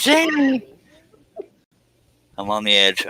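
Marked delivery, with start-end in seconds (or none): 0.0-0.6 squeaky high Jamie. Acted. Reading comment.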